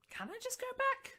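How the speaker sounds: very high little voice; timid young elf. Acted. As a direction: squeak out a hesitant child's question. Young elf timidly asks to leave.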